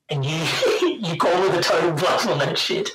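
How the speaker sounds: amused, helpless real laughter; laughing. Real, not acted. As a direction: laugh your way through the admission. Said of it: "Sorry, I'm laughing".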